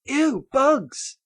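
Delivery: high, loud mock disgust; imitating squeamish moviegoers. Mimicking moviegoers at the bug scene, mock disgusted squeal. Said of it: "I was imitating them".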